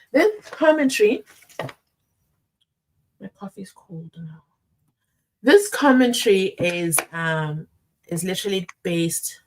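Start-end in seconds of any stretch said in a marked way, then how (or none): 3.2-4.4 whispered aside, much softer. Real, not acted. Streamer muttering about cold coffee, whispered aside. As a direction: whisper it to yourself.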